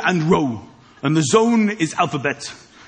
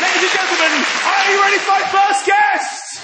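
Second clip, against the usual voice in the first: big compere intro; announcer build-up; 12 semitones higher, 3 dB louder than usual. Acted. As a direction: whip the crowd up for the act.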